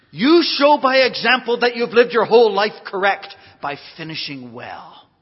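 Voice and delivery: loud, emphatic pulpit delivery; preaching. Real, not acted. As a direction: drive each point home to the congregation. Preaches at full force.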